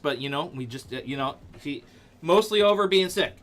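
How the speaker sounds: ordinary talk, nothing marked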